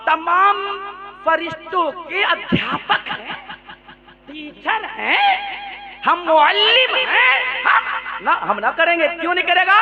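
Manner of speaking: arrogant Iblis. Acted. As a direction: sneer your refusal like proud Iblis. Voicing Iblis refusing. (घमंडी इब्लीस की ऊँची आवाज़)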